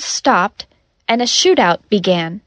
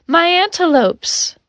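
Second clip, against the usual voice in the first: drawn-out, high-pitched wail for the antelopes; mild shout; 8 semitones higher than usual. A narrator's reading. Asher playing shocked patient on the ground, mild shout. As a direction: cry out high and slow, with little force. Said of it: "sat on the ground and shouted".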